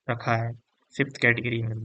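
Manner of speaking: ordinary delivery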